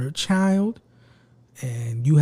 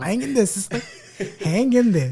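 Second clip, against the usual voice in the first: laughing; 4 semitones higher than usual. Retold or imitated. Voicing girlfriend's encouragement, laughing.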